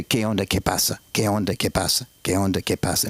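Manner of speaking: low Mexican cashier patter; repetitive, low-pitched Mexican greeting. Retold or imitated. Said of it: "I'll do a Mexican voice".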